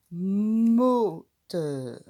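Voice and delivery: loud teaching Moteur; loud model word. Shown as a demonstration. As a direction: call Moteur out to the class. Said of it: "on entend le mo".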